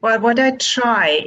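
ordinary talk, nothing marked

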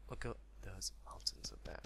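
soft, whispered awe; hushed wonder. Real, not acted. Gamer admiring distant mountains, whispered awe.

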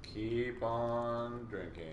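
drawn-out, slow chant; very slow drawl. Acted. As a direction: stretch every word out slowly. Cheering a friend to drink.

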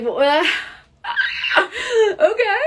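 high, loud nervous laugh into agreement; nervous laughing reply. Retold or imitated. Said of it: "I was super nervous".

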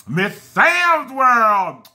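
slow, sing-song shout-out of a fan's name; announcing a top fan slowly; drawn-out name call. Real, not acted. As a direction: stretch the name out slowly and proudly.

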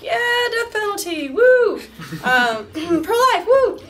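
mock cheering. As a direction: cheer like a dumb pep rally fan. Mocking her old conservative self.

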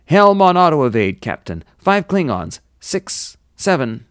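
loud, high alert to the captain; urgent report. A narrator's reading. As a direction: call out threats as they appear. Bridge crew calls out incoming enemy ships.

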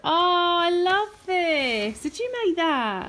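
mother thanking her son for his card; delighted gushing. Real, not acted. Mother receives child's gift.

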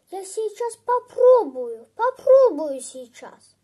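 loud, excited outburst; eager boy. Acted. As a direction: burst out, keen to try it at once. Boy eager to try magic word.

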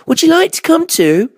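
high, loud eager invitation; high child-like character; Baby Bear. A narrator's reading. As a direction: ask brightly as a little cub.

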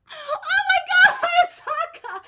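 slow, high, loud wail of delight; overwhelmed squeal. Real, not acted. Fan reacting to Hayasaka's romantic plan, overwhelmed wail. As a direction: cry out her name, overwhelmed.

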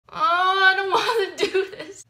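squealing with embarrassed laughter; embarrassed laughing. Real, not acted. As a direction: laugh through the cringe. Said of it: "I'm over dramatic".

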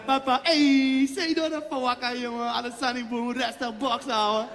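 relaxed Surinamese-Dutch street talk, slowed down; laid-back Surinamese coach. Retold or imitated. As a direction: coach telling his player to keep calm, easy Surinamese accent. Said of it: "Let op".

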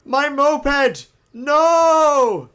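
high, loud cry of loss; anguished yell. Real, not acted. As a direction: wail for the wrecked moped. Moped destroyed.

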